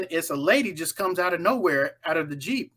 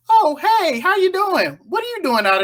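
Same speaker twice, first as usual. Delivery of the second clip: high, louder cheery hello; mock bright surprised greeting; friendly surprised greeting; 12 semitones higher, 6 dB louder than usual. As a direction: greet a familiar face brightly. Owner greets a known person.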